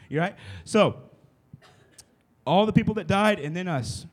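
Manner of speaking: normal talking voice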